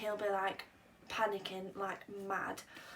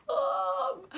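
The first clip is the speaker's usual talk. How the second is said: high, loud call of Mum; shout; 8 dB louder than usual. Retold or imitated. Lost girl spotting her mum at the bar, shouting. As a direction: yell across the pub for her mum. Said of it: "I literally just shout".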